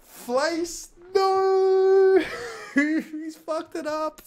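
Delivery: high, loud cry of dismay laughing; mortified yelp. Real, not acted. Streamer reacting to his own slip, loud dismay. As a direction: shriek at his own blunder. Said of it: "I said flace instead of face".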